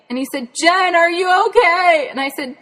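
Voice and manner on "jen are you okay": high, loud calling into the brush. Retold or imitated. Dad searching the woods for Jen, calling out in a high loud shout. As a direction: shout as if hunting for a lost child.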